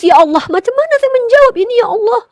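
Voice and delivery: preacher recalls crying out to God in distress; retold tearful prayer. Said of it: "Saya menangis".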